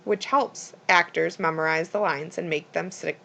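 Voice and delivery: ordinary delivery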